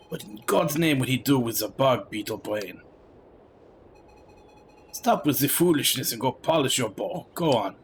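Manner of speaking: put-on grumbling Frenchman with a heavy accent. French soldier scolding companions in the wilderness, heavy French accent. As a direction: read it in a thick, disdainful French accent. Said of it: "as if he's speaking with a French accent".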